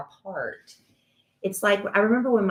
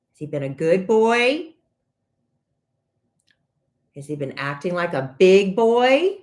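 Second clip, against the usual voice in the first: put-on 1 talking to a toddler; 6 dB louder than usual. Questioning a toddler nephew.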